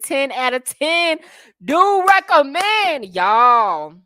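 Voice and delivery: high, slow, drawn-out gush; excited endorsement. Real, not acted. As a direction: sell it like a rave review. Enthusiastic product-style endorsement.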